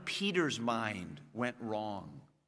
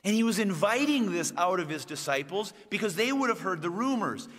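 ordinary talk

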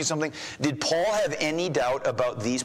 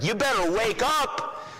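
high, slow, fist-shaking warning; mock stern warning; 9 semitones higher than usual. Paul imagined threatening his church, mock stern warning. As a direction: wag a finger and threaten them to wake up. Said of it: "not shaking a fist at".

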